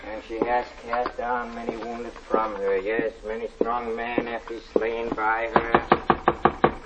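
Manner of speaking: slow, soft intoning; reading the Bible to himself; hushed slow recitation; solemn scripture reading. Acted. Lodger reads scripture aloud.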